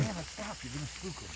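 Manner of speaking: very soft, urgent telling-off; hushed scolding. Real, not acted. Stopping someone from spooking the caiman, hushed scolding. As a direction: scold under your breath.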